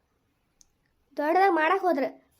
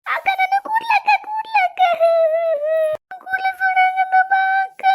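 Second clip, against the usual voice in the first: frightened high cry; 10 semitones higher, 3 dB louder than usual. Acted. Hair catches fire, girl cries.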